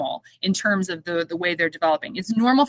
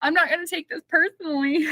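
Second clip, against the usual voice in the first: high, tearful laughing through the words; laughing to tears about her son's rejection; crying and laughing; 11 semitones higher than usual. Real, not acted. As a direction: laugh so hard it nearly turns to tears. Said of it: "crying and laughing".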